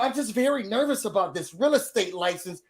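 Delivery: normal talking voice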